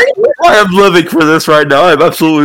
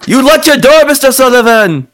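much higher voice calling through the door; cheerful complaint through a locked door; Alejandro calling out; 11 semitones higher than usual. Acted. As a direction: sidekick calling to his boss through the door.

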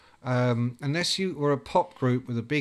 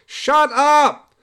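much higher and louder cry of disbelief; mock outburst; 13 semitones higher, 11 dB louder than usual. Band mates hearing Rob went off with a friend, shouting.

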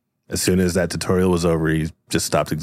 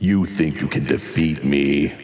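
menacing villain voice; 5 semitones lower than usual. Acted. Villain Cristobal taunts.

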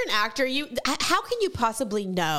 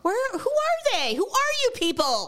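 baffled; 13 semitones higher, slower than usual. Real, not acted. Baffled by new followers.

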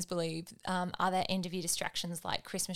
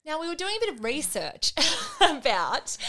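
higher, louder, laughing; 9 semitones higher, 10 dB louder than usual. Real, not acted. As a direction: giggle through the opening. Laughs while starting topic.

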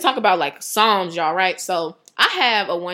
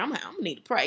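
softer, half-joking mock solemnity; goofy; 10 dB quieter, faster than usual. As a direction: toss it off goofily, mock serious. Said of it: "I was being goofy. Like, ... the way I said it is like".

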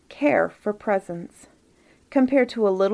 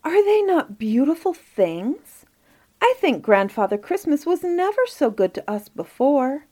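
put-on higher-pitched delighted little boy; excited cry; 6 semitones higher than usual. A narrator's reading. Inge to the children about his gifts, excited cry. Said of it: "he cried".

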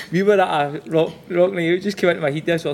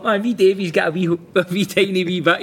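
ordinary talk, nothing marked

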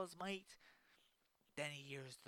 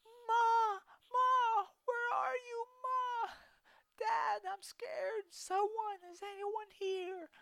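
much higher, louder frantic cries for Ma; scared screaming; 11 dB louder, slower than usual. Acted. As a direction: call out lost and terrified. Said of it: "he starts to scream out".

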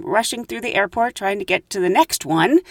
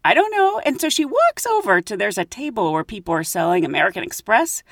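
usual speaking voice

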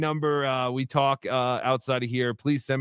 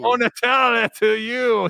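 hammy Italian name, louder and higher; fake Italian accent; 7 semitones higher, 5 dB louder than usual. Shown as a demonstration. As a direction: roll it out like a mock Italian host. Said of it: "See, I'm a fake Italian".

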